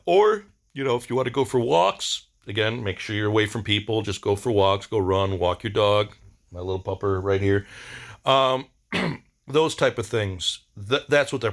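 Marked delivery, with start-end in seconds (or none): none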